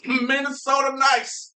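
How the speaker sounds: high, slow, hammed-up Minnesota accent. Minnesotan repeating the local slogan, exaggerated Minnesota accent. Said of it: "Minnesota accent".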